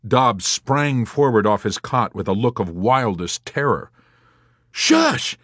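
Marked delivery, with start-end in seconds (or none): 4.7-5.4 loud, high-pitched roar; roared shush. A narrator's reading. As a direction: roar for silence in terror. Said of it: "he roared".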